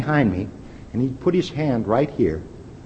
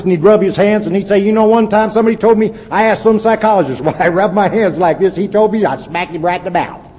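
higher, louder Texas salesman voice; Jimmy Ryan; 8 semitones higher, 6 dB louder than usual. Retold or imitated. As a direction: imitate a Texan salesman, pitched up and loud. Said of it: "he'd talk like this".

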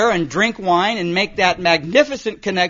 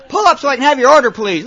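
higher, louder, fast impatient clerk; pissy drive-thru guy; 6 semitones higher, 6 dB louder, faster than usual. Retold or imitated. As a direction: rattle it off like an irritated speaker-box voice. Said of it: "Little pissy guy".

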